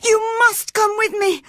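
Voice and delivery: high, loud, crying scream of terror; terrified boy screaming at Cathy not to leave; wild scream. A narrator's reading. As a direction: scream in panic, clinging on. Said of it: "screamed Linton wildly".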